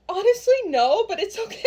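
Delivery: imitating a flustered mom, high-pitched; high, flustered retold reply; embarrassed. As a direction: play an embarrassed mom brushing it off. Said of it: "My mom is so embarrassed".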